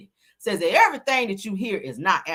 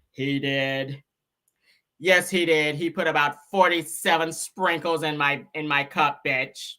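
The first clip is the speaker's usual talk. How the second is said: mock witness; 5 semitones lower, slower than usual. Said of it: "That was her in court".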